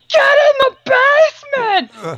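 high, drawn-out imitated shriek; mock panicked scream. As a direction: scream the order in hammed-up panic. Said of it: "all of a sudden you hear".

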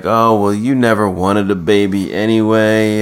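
ordinary delivery

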